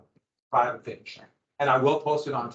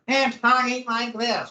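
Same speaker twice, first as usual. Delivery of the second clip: higher nasal voice, a bit slow; nasal Lord North voice; 8 semitones higher than usual. Retold or imitated. Teacher showing students Lord North's voice, nasal. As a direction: squeeze the words through your nose. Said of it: "talked like this through his nose".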